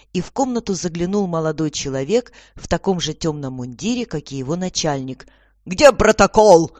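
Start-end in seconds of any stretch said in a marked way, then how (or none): 5.6-6.7 loud, slow, irritated demand; displeased. A narrator's reading. Commissioner to subordinate, displeased and loud. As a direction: demand it loudly, drawn out. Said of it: "недовольно спросил комиссар".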